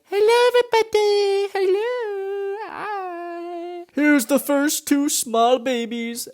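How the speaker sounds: very high squeaky little-person voice; hammed-up high, drawn-out tiny greeting; tiny people. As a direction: squeak it out slowly, as if shrunk. Voices shrunken people on a cart.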